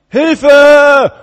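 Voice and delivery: high desperate scream; cry for help. Retold or imitated. Neighbor in pain crying out at night, desperate scream. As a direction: scream for help from the next cell. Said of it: "Wieso schreit er Hilfe?".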